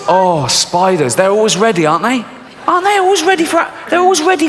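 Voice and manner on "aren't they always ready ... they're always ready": high, fast, worked-up rant; exasperated rant. Real, not acted. Comedian ranting to the audience about spiders, high and fast. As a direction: rattle it off, pitched up in disgust.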